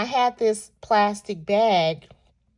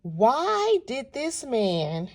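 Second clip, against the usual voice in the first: normal talking voice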